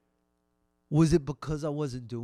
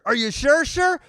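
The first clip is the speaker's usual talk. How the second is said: high, loud pleading check; begging; 11 semitones higher, 10 dB louder than usual. Acted. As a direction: press needily, pitch climbing on the end. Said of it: "they beg you to".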